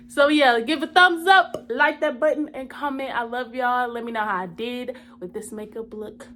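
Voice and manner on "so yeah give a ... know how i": excited outro. Real, not acted. Energetic outro call to action.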